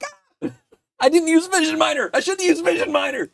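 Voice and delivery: high, fast panicked wail; panicked regret. Retold or imitated. Maker realizing the bed was dirty, high mock panic. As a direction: squeal in mock horror at your mistake. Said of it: "I'm like".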